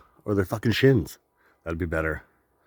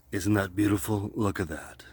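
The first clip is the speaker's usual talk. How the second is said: softer, dry mock-admiring radio read; sarcastic radio voice; 3 semitones lower, 7 dB quieter than usual. Shown as a demonstration. Practicing his radio voice on skimpy flowers, sarcastic. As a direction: sell it like a DJ who doesn't mean it. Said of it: "a sarcastic radio voice".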